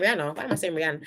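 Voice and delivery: usual speaking voice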